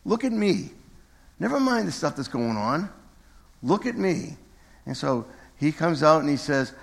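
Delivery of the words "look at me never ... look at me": lightly acted, higher-pitched call for attention; Jesus. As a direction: urge him to focus, pitch lifted. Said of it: "picturing Jesus doing the same thing to Peter".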